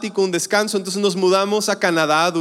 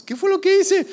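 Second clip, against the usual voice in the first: bewildered; 10 semitones higher than usual. Retold or imitated. Confused boy sent inside.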